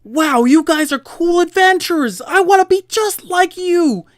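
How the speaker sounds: high-pitched fanboy pleading with the adventurers to take him along; starstruck fan NPC. Acted. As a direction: beg the heroes like an adoring tagalong. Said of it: "a character that says".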